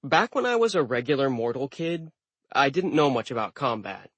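ordinary delivery

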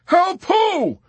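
high, loud, urgent shouted question; calling back. A narrator's reading. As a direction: yell back frantically over the intercom. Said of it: "Yossarian called back".

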